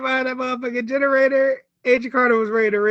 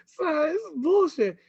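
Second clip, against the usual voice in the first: high cry; 7 semitones higher, slower than usual. Real, not acted. Upset about Erskine's death.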